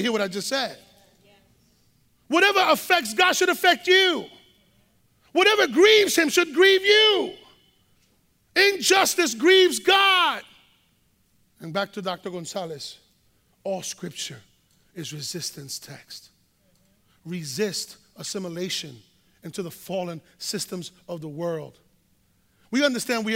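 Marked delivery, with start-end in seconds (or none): 2.3-10.4 preaching shout. Real, not acted. Preacher crying out to the congregation, building shout. 11.6-21.8 quiet after climax. Real, not acted. Sudden drop after climax.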